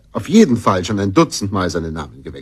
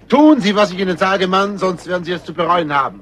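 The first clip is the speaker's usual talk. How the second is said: loud stern order; loud threatening order; stern threat; 9 semitones higher, 3 dB louder than usual. Acted. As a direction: command him with menace. Threatening the undertaker.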